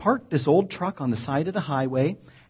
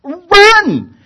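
full-throated urgent yell, much higher and louder; 12 semitones higher, 16 dB louder than usual. Retold or imitated. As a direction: scream it to warn your friends. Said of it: "I yelled to my roommates".

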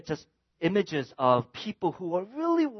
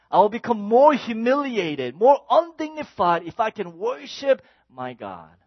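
raised, high, impassioned declaration; fervent vow; 6 semitones higher, 6 dB louder than usual. Acted. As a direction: declare your devotion loudly. David's defiant vow of worship.

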